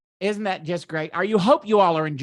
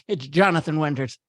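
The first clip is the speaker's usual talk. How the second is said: normal talking voice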